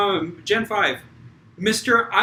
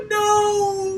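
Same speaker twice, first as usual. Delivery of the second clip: high laughing protest; 11 semitones higher than usual. Real, not acted. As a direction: laugh through the words. Laughing at joke.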